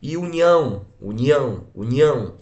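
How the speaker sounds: "união" with a loud, strong final "ão"; tônica no final. Shown as a demonstration. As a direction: repeat "união", punching the last syllable. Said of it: "Está aqui no final". ("união" com força no "ão" final)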